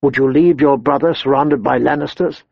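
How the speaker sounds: ordinary delivery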